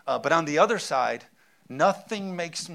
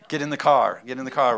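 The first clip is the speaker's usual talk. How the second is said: loud parent command; 2 semitones lower, 8 dB louder, faster than usual. Retold or imitated. Parent hurrying kids.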